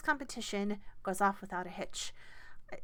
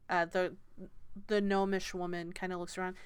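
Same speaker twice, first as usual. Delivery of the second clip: ordinary talk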